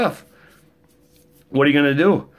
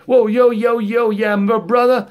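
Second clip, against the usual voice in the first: mock rapper; 9 semitones higher, 3 dB louder than usual. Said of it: "He decides".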